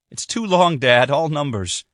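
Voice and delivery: high, strained plea; exasperated at the hopeless situation; frustrated despair. Acted. Sydney tells her father she can't remember it, despairing.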